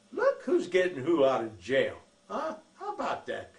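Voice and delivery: loud playful ribbing with a laugh; loud tongue-in-cheek gloating, laughing at the end; teasing. Retold or imitated. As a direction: tease her to make her laugh. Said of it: "All of that was tongue in cheek".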